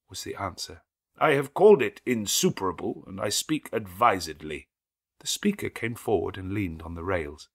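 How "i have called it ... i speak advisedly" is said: a stranger halting the wedding; stranger's interruption. A narrator's reading. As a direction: cut the ceremony off firmly. Stranger voiced.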